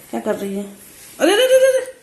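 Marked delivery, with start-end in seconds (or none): 1.2-1.9 high loud alarmed cries; alarmed shout. Real, not acted. Mother crying out as the baby tumbles.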